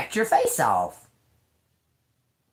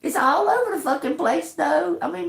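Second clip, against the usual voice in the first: usual speaking voice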